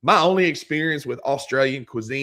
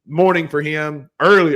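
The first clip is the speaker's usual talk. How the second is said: usual speaking voice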